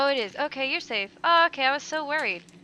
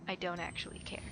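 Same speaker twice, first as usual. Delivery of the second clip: normal talking voice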